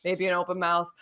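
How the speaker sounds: normal talking voice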